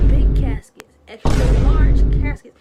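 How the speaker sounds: loud, emphatic list; loud incredulous outburst; building shout. Real, not acted. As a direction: shout each size bigger and bigger. Listing ever bigger caskets.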